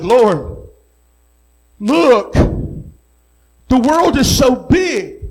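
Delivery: high, loud, awestruck cry to God. Retold or imitated. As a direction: stretch it out in overwhelmed appeal. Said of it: "And I began to cry, and I said".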